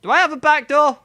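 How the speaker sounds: high, loud panicky question; panicked squeak. Acted. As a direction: blurt it out in sudden panic. Panicked character wonders aloud.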